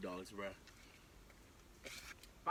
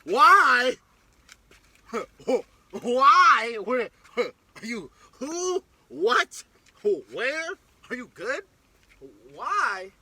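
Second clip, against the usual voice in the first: loud, higher repeated whys; frantic questions; 4 semitones higher, 10 dB louder, much slower than usual. Retold or imitated. She badgers the returning walker with loud frantic questions. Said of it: "she's sitting there talking about some".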